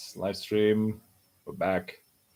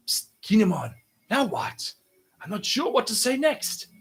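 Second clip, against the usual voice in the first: high, hushed-style panicky aside; unsure young leader; 9 semitones higher than usual. Acted. Young leader asking Kinemon what to say, high unsure voice. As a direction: slip out a high, helpless aside.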